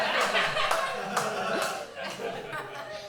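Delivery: airy laugh under the music; breathy laugh. Real, not acted. Comic laughing at her own punchline, airy laugh. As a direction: let a soft unvoiced laugh out.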